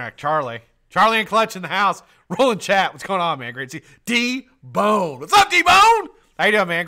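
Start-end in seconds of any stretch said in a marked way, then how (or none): 4.0-6.9 loud, very high hollered greeting; excited call-out. Real, not acted. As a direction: build to a big whooping welcome. Hailing an arriving viewer.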